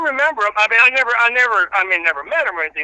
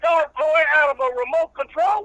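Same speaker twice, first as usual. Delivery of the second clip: country preacher character; 9 semitones higher than usual. Acted. Old reverend preaching patience to the radio host, in full preacher voice. Reverend rants about dog toys.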